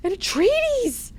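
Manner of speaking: high-pitched, hammed-up panic; freaked out. Duncan who just tried to kill an Atreides, freaked-out panic. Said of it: "he also is freaked out".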